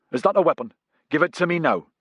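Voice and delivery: commanding challenge; stern demand. A narrator's reading. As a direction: bark it like a sentry with a rifle. Soldier demands weapon at gunpoint.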